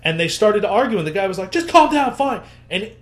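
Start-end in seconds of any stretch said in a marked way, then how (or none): 1.5-2.4 much higher, impatient; exasperated. Retold or imitated. Dealer to partner during the argument, higher exasperated. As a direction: brush off the argument.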